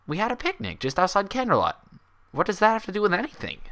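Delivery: a sharp, disbelieving unicorn insisting she's sane; Twilight's voice. Acted.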